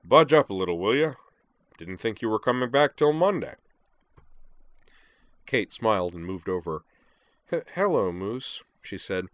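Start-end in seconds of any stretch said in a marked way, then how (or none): 0.0-3.5 loud, friendly banter; booming giant. Acted. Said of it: "deep and rich and resonant". 7.5-8.6 slow, lightly stammered chat to a friend; mild stutter. Acted. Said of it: "milder Kate's stutter".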